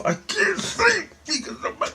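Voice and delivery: very high, strained squeal; frantic complaint. Retold or imitated. Telling her the noise won't let him sleep, frantic squeal. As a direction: squeak it out at the very top of your range.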